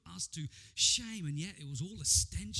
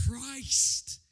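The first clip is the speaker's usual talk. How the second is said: ordinary talk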